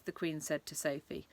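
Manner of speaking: plain reading voice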